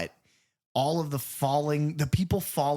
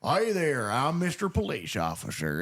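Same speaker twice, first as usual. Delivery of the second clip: mock Southern accent. Podcaster mocking how everyone in the movie talks, Southern accent. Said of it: "Nobody talked like that".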